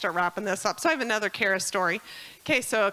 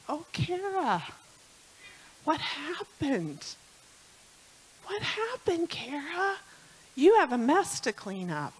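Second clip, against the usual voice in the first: patient husband; 6 semitones higher than usual. Retold or imitated. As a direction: coax her kindly, no anger. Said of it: "so peaceful, so patient".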